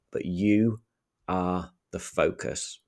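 a held pause before "are the focus", then slow; pause for effect. Shown as a demonstration. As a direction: let "you" hang, pause, then slowly "are the focus". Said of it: "I would leave a pause to let that sink in".

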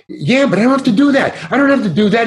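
high-pitched mock protest. Actor objecting to the director, retold in high-pitched protest.

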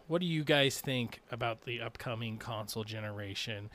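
ordinary talk, nothing marked

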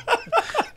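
sudden high laugh; high burst of laughter. Real, not acted.

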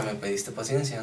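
low, soft imagined voice of God; voice in head. Retold or imitated. God's voice in his head reminding him, hushed. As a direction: hush it like a voice inside his head.

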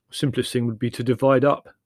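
brisk practical brother; blunt brother. Acted. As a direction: propose it loudly and firmly. Voices Leslie.

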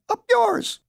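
very high, loud bird screech; piercing, jeering bird call; crying bird. A narrator's reading. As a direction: squawk the insult like a bird. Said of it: "the birds cried".

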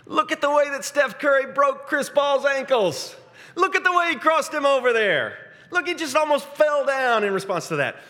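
high, loud, gleeful play-by-play gushing; excited kids watching highlights. Retold or imitated. Kids hyping a basketball highlight, high excited voice. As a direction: gush like a kid replaying a crossover. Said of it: "my kids watching highlights".

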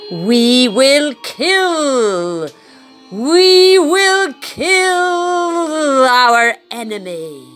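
slow, menacing villain vow; villain declaration. Acted. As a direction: drag it out like a cartoon villain.

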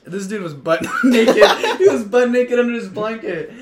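ordinary talk

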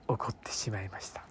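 dropped to an ominous whisper; hushed whisper. Real, not acted. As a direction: whisper it like a dark secret. A dreaded mistake revealed.

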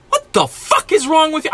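sudden high, exasperated yell of disbelief; exasperated outburst. Real, not acted. Ranting at rude customers, a high exasperated outburst. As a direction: blurt it out, fed up with people.

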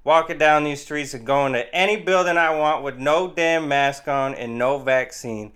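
loud mock heartland rant; yelling hodgepodge Southern-style accent. Mock sovereign citizen refusing mask and vaccine, yelling in a hodgepodge accent. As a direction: holler like a put-on middle-of-the-country rebel. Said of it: "Why are you yelling?".

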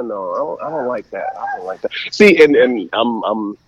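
usual speaking voice

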